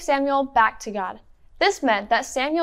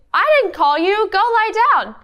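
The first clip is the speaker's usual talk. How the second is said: put-on raised, louder old priest line; Eli's line; 6 semitones higher, 3 dB louder than usual. Shown as a demonstration. Teacher models the priest's line for the kids, raised and louder. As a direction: speak up as the old priest sending him off. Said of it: "your line will be".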